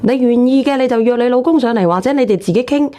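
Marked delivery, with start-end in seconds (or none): none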